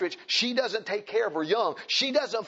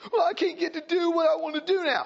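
mocking whiny mother; 11 semitones higher than usual. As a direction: squeal it like a spoiled complainer. Mocking the complaining mother again.

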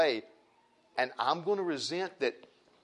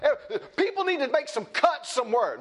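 high amused mock of the public; laughing mockery; 11 semitones higher, 4 dB louder than usual. Preacher mocking people demanding cuts, high laughing. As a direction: chuckle through it, mocking.

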